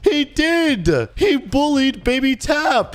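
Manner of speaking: high, insistent accusation; indignant protest. Acted. Dad insisting to the bully's father, indignant protest.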